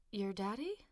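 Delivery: soft, faltering question; weak tone. Acted. Mother hearing the father is here, weakly. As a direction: let the voice go weak. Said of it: "Roxanne's tone went weak".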